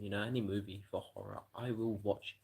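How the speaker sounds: normal talking voice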